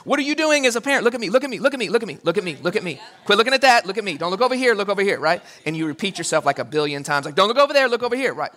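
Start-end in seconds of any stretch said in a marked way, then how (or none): 0.0-3.0 high, fast pleading parent, fading at the end; frantic parent. Retold or imitated. Frantic parent distracting crying kid. 3.2-5.2 put-on higher, louder coaxing of a child; pleading parent. Parent distracting crying kid. 7.4-8.3 put-on higher, louder coaxing of a child; pleading parent. Parent repeating distraction.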